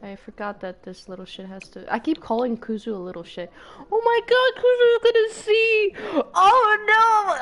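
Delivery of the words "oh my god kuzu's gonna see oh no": high, loud half-laughing wail; mock panic. Real, not acted. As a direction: squeal in playful panic. Panicked, Kuzu's gonna see.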